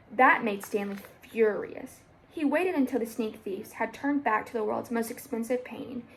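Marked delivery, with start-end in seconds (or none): none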